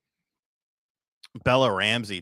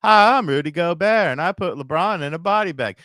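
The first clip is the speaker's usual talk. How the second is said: mock Rudy Gobert voice; 6 semitones higher, 6 dB louder than usual. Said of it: "reading a donation message in character".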